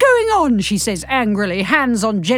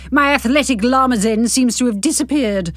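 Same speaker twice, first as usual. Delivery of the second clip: usual speaking voice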